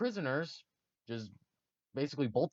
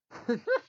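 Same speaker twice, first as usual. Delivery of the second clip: high giggle; 3 dB louder than usual. Real, not acted. Laughing at his story.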